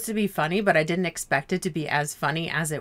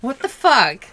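mock stunned viewer; 6 semitones higher, 6 dB louder than usual. Viewer reacting to scene ending.

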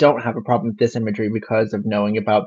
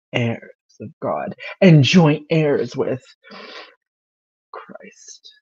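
breathy whispered ending; sexy whisper; slower than usual. Pretend. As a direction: trail off in a seductive whisper. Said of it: "sexy voice, [name]".

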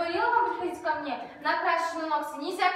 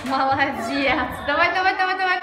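loud coaching; 7 dB louder than usual. Real, not acted. Coach urging children during a drill.

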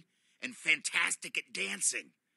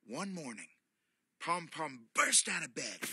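ordinary delivery, nothing marked

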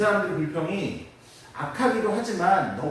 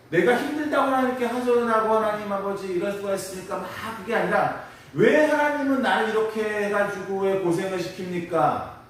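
impassioned preaching; 6 semitones higher than usual. Acted. Preacher voices a complaining prayer.

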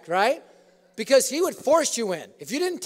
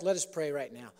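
ordinary talk

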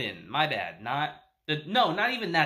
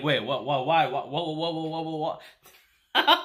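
mock panic; 3 semitones lower than usual. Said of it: "the promoter starts freaking out".

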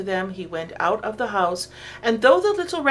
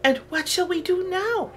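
much higher eager question; eager little rabbits; 10 semitones higher than usual. A narrator's reading. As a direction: bunnies asking for the next prank. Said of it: "they asked".

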